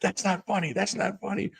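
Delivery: high, soft mock reproach; imitated scolding companion. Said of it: "my Tara's like".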